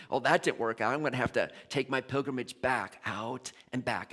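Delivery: mildly sheepish confession. Retold or imitated. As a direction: mumble it like a guilty son. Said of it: "kinda sheepishly".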